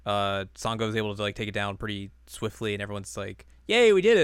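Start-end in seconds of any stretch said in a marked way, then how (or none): 3.7-4.2 cheering. Retold or imitated. Group cheering in retold scene.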